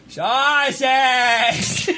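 put-on high, drawn-out old lady cry. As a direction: mimic an old lady at the deli counter. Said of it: "she points and she goes".

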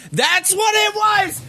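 high, slow eureka shout; excited realization. Real, not acted. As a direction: shout as it clicks. Sudden realization.

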